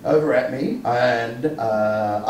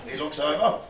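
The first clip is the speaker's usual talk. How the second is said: ordinary delivery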